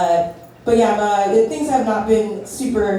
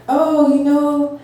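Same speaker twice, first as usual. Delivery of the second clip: put-on pastor's sermon in a higher-pitched Haitian accent; 8 semitones higher than usual. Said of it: "so I'm gonna do an accent".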